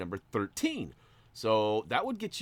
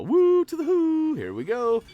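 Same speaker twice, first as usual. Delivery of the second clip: much higher, louder and slowed whoop; excited whoop; 11 dB louder than usual. Real, not acted. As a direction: rev yourself up for the next race. Cheering at race start.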